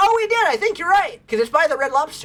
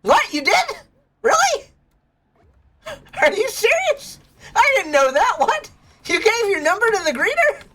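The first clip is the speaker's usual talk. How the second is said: much higher, squealing can't-believe-it; shocked disbelief; 12 semitones higher than usual. Real, not acted. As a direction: gasp out each question in disbelief. Shocked by friend's news.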